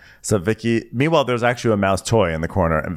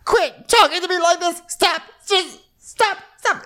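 very high-pitched mock meltdown; hammed-up squealed outburst; Tamra losing it; slower than usual. Housewife telling the hostess to stop, mock-hysterical. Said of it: "loses her mind".

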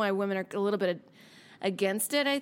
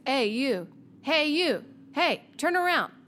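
imitated calling out; 5 semitones higher, 3 dB louder, slower than usual. Retold street harasser calling out.

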